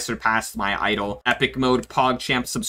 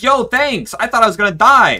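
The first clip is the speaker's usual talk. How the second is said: relieved excitement; 10 semitones higher, 7 dB louder than usual. Retold or imitated. Relieved thanks to staff.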